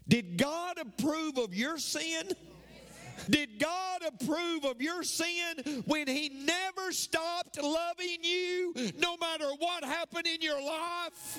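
high, sustained shout with drawn-out words; impassioned preaching shout. Real, not acted. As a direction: cry it out to the whole church, slowly, at full force. Preacher challenging congregation at full force.

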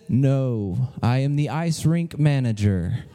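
deep, slow, loud voice from above; mysterious voice. Acted. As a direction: boom it out low like a voice from heaven. Mysterious voice answers as manager.

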